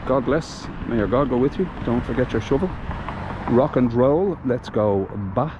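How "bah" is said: usual speaking voice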